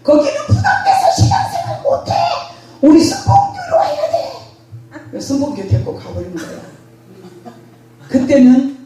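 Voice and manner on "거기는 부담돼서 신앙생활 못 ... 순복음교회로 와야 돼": much higher, laughing mock panic; hammed-up frantic friend, laughing; frantic pleading. A frantic friend insists she must come to their church, mock panic. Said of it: "난리 날 것처럼 난리를 치면서".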